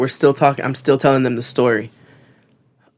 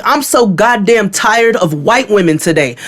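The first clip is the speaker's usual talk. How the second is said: slow, high exasperation; heated, drawn-out complaint; hot, retold anger; 7 semitones higher, 3 dB louder than usual. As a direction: re-enact being completely fed up, slowly. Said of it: "I'm hot".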